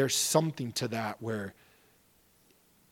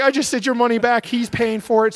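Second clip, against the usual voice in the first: much higher and louder, rushing, overcome; emotional, near tears; 12 semitones higher, 13 dB louder than usual. Retold or imitated. Calling the Marine with the news, high and overwhelmed. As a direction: blurt the good news while choking up. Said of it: "I'm crying like a baby".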